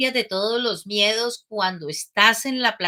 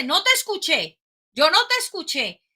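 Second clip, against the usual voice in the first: teasing instructor; 6 semitones higher than usual. Retold or imitated.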